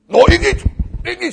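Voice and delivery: high, loud denial; emphatic acted objection; loud boastful protest. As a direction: protest over everyone as cocky Peter. Peter protests loudly, acted.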